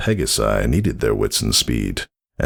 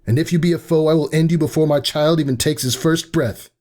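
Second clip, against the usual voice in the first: fierce threat. A narrator's reading. Laboring mare warns unseen foe, high fierce threat. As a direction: threaten the intruder to protect the child.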